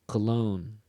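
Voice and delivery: "Cologne" with "logne" held longer and stronger; stress on second syllable. Shown as a demonstration. Teacher repeating the pair so students hear "Cologne" stressed at the end. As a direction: keep "co" short, lean on "logne". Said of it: "Listen again".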